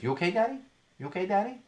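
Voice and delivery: a hint of a small child asking "Daddy"; little daughter. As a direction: ask it gently like a toddler making sure. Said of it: "She says".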